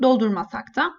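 ordinary talk, nothing marked

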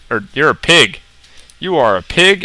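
normal talking voice

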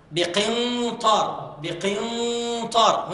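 بقنطار with a strong hidden nun and a heavy, full nasal before the ط; heavy ghunna. Shown as a demonstration. Teacher showing the class a heavy ghunna, slowly. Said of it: "هنا الإخفاء قوي والغنة مفخمة".

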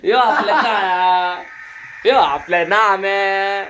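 slow, loud name call; drawn-out announcing. Acted. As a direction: stretch each nickname out loudly. Announcing friends by nickname.

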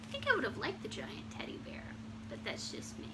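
soft confiding near-whisper; whispered aside. Real, not acted. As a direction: drop to a private aside. Personal aside after the story.

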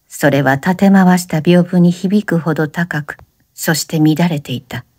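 ordinary delivery, nothing marked

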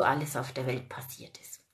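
conspiratorial whisper. Real, not acted. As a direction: let them in on a secret. Conspiratorial hint to viewers.

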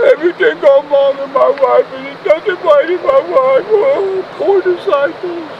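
high, put-on woeful whine; exaggerated poor-me moaning; mock sad. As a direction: lament in a hammed-up pitiful voice. Mocks the sad-looking disciples.

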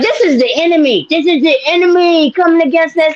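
imitating a spooked churchgoer; mock alarmed believer. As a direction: play up the panic. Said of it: "You were like".

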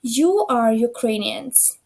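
"we are Ukrainians", every word drawn out slowly; slow model sentence. Shown as a demonstration. Teacher reads example to class, very slow and clear. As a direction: spell it out slowly so students catch the final s. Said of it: "Що це означає?".

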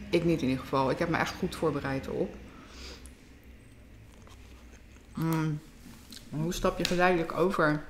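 speaking between bites; chewing while talking. Real, not acted. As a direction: mouth partly full of food. Seen 0: Ate just before; lips pressed chewing first seconds, then speaks clearly.

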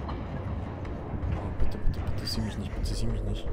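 whispered pleading; begging under the breath in fear; panicked whisper. Real, not acted. Hiding from killer.

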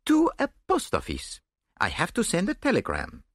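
high, lively and cheerful; bright-spirited, bouncing up in pitch; gaily. A narrator's reading. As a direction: announce the errand gaily. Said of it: "he said gaily".

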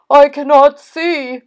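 high, loud cry from Toad; storybook panic; distressed cry. A narrator's reading. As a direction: cry out helplessly, as if blinded. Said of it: "cried Toad".

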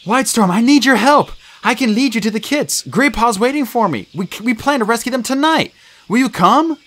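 high-pitched, insistent plea; urgent. A narrator's reading. Firepaw begs Whitestorm to join the rescue, urgently. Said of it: "He meowed urgently".

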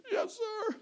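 tiny, very high tearful squeak; mock whimpering child. Daughter admitting her lie to dad, mock whimper. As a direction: whimper like a guilty little girl.

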